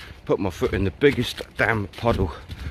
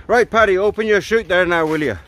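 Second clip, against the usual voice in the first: loud, high, hammed-up Irish voice; terrible Irish accent; 7 semitones higher, 4 dB louder than usual. Murphy the instructor calling to falling Paddy, mock Irish accent. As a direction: call it out in a bad Irish brogue. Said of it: "apologies for the terrible accent".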